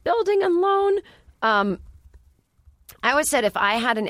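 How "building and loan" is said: high, drawn-out imitation of a yell; mock yell, stretched and high-pitched; George Bailey. Quoting the end of the film, mock yelling. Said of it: "George Bailey yelling".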